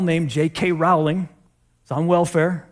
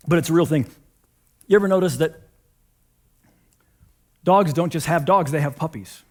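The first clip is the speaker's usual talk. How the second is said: usual speaking voice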